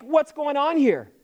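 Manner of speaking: high, slow, exasperated demand; a little ticked. Retold or imitated. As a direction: imitate a miffed parent wanting answers. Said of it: "They were a little ticked".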